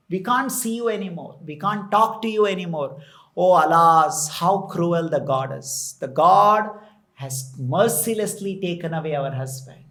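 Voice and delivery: ordinary talk, nothing marked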